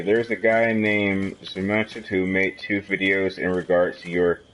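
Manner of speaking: ordinary talk, nothing marked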